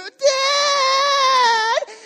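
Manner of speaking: high, loud shriek of alarm; screaming kids. Retold or imitated. Kids screaming at dad chasing them, shrill scream. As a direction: scream like a kid being chased. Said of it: "the kids are screaming".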